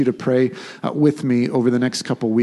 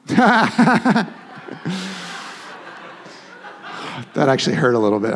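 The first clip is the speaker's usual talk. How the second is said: laughing, then wincing at the hurt; laughing through pain; 6 semitones higher than usual. Real, not acted. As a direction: laugh that catches in sore ribs. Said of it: "I can't laugh yet".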